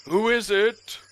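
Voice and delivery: high, loud servant's call from inside the tent; Permian voice. Acted. Servant answers a knock from inside the tent, high call.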